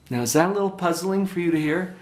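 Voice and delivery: usual speaking voice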